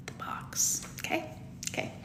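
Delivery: hushed whispered echo; barely-there whisper for emphasis. Real, not acted. Hushed echo of own phrase.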